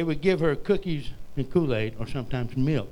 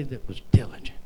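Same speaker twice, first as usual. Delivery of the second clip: whisper; 4 semitones lower than usual. Real, not acted. Preacher drops to a whisper.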